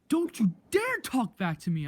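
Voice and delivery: high, loud mock scolding; imitated angry teacher. Teacher warning a student who answers back, mock angry shout. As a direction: mimic a teacher's outraged outburst. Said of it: "She's like this".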